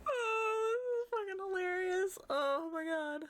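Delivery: high, breathless, words through laughter; laughing while talking. Real, not acted. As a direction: talk while still cracking up. GM still laughing after a fit.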